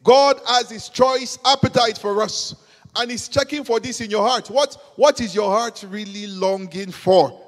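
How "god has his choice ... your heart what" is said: ordinary talk, nothing marked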